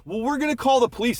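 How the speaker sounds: high-pitched mock threat at normal volume; retold yelling. Dog owners threaten the man after the kick, mock yelling. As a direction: imitate indignant owners, pitched well up. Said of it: "they started yelling at me".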